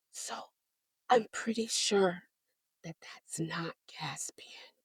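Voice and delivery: hushed, hesitant confiding, fading toward a whisper; nervous buzzing. Acted. Confiding that it isn't Caspian, nervous hush. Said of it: "buzzing, like, bouncing from".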